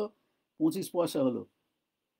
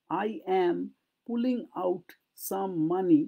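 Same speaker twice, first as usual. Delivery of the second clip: usual speaking voice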